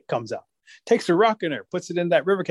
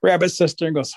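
ordinary delivery

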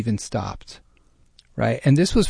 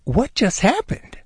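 slow, higher, baffled question; wife asking, stunned, what just happened; bewildered wife; 8 semitones higher, slower than usual. Retold or imitated. Lightly voiced baffled wife in the driving car. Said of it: "she goes".

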